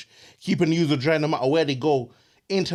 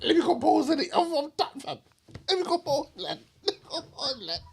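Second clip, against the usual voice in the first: stifled laughter; 12 semitones higher than usual. Real, not acted. Trying to calm after laughter.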